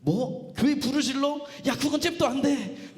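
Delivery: worked-up, dragged-out refusal; indignant Paul. Acted. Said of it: "바울이 그러는 거예요".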